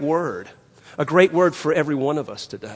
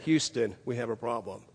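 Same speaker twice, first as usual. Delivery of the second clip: much softer, unruffled report; calm; 8 semitones lower, 10 dB quieter than usual. Retold or imitated. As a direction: report the disaster coolly, almost murmured. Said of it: "can remain so calm".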